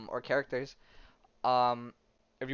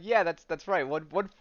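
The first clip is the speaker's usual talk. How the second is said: put-on Russian accent; 5 semitones higher, 5 dB louder than usual. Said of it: "it's in a Russian accent".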